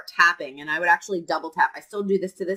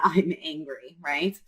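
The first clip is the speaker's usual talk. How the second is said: ordinary talk, nothing marked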